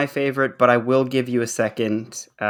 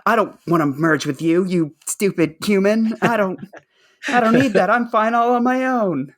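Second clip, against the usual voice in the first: climbing ever higher into a squeaky cartoon-brat voice; mock tough Anyanka; 9 semitones higher than usual. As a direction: play a snooty little tough guy, pitch going up and up. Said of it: "suddenly become, like, Stewie Griffin".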